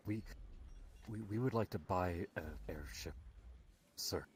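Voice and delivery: 低声紧张地说话; hesitant, quiet and halting; nervous. Acted. Nervous party member addresses foreman.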